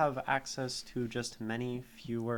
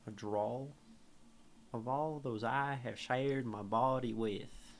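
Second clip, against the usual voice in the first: lightly drawled Southern US reading; a hint of a Southern drawl; Southern accent; slower than usual. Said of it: "this poem is so wrong in a Southern accent".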